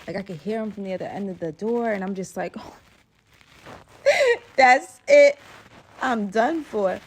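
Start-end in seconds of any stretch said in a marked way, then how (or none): 3.6-4.5 loud, high, disbelieving laugh; nervous laugh. Real, not acted. 4.6-7.0 dramatic despair. Real, not acted. Dramatic doom after laugh.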